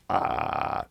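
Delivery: low, soft creaky "ah"; vocal fry. Shown as a demonstration. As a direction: let "ah" crackle at the bottom of the voice. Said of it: "it's really just a vocal fry".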